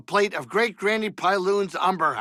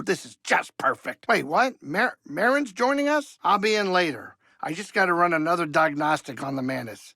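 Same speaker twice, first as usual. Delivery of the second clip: ordinary talk, nothing marked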